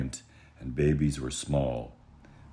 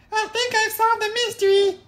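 extremely high, louder little-cub voice; a proud tiny cub detective; Baby Bear; 9 dB louder than usual. Acted. Baby Bear announcing his discovery, tiny high proud voice. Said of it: "Baby Bear said".

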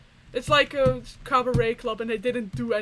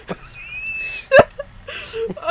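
high crying laugh; shrieking laugh; 7 dB louder than usual. Real, not acted. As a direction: laugh till it squeaks. Sauce spill chaos.

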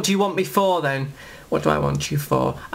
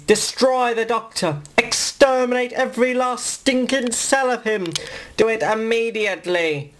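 high, grating exterminate command; Dalek-style order; 6 semitones higher than usual. Acted. Davros orders the Daleks to destroy the Doctor, high and grating. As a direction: spit out the order to kill.

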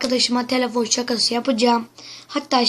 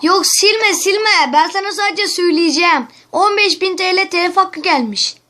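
telaşlı oyunculuk; 6 semitones higher, 4 dB louder than usual. Acted. Şakayı sürdürüyor.

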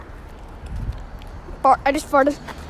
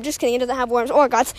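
ordinary talk, nothing marked

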